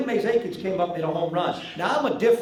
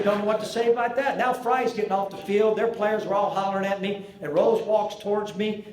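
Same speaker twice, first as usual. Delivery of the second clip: ordinary talk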